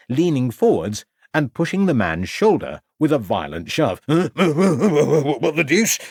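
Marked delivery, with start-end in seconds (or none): 4.1-6.0 slow, startled stutter, just woken. A narrator's reading. Constable jolted awake by inspector, slow startled stutter. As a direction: drag it out, groggy and confused. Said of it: "stuttered the uniformed officer".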